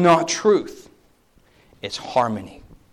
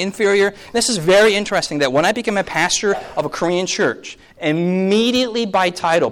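ordinary talk, nothing marked

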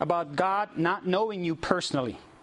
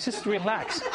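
laughing; 6 semitones higher, slower than usual. Real, not acted. Laughing while reassuring lady.

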